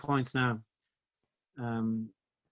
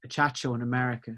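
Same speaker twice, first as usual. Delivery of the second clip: ordinary delivery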